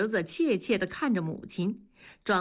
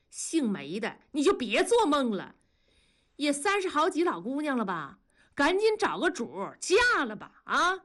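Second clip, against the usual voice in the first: high-pitched taunting; sneering; 7 semitones higher than usual. A narrator's reading. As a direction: jeer at your rival with scorn. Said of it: "庄嫂冷笑着说". (高声冷嘲热讽)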